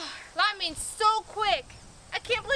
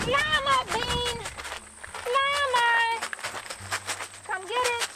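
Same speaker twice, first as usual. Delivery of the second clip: high, drawn-out call for a lost pet; 5 semitones higher, much slower than usual. Real, not acted. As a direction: call the pet in, stretching the name.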